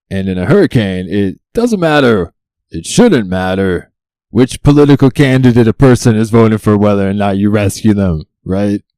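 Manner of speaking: loud, exasperated argument; loud, worked-up insistence; indignant rant. Real, not acted. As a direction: raise your voice in protest. Indignant rant about rescues.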